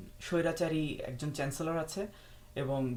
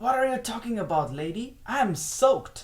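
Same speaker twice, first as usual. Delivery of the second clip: loud, high-pitched indignant outburst; indignant exclamation; 7 semitones higher, 7 dB louder, much slower than usual. Acted. German tourist answers the woman who asked him, loud indignant exclamation.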